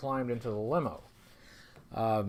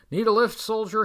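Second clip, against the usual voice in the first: a higher, shouted offer over the rotor noise; yelling; 6 semitones higher, 3 dB louder than usual. A narrator's reading. Pilot Ripcord calls down to the soldier, yelling. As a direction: call out to him from the chopper. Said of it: "he yells".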